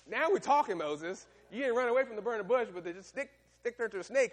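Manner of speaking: preacher teasing Moses for running from the snake; excited mock taunt. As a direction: rib him, all worked up and fast.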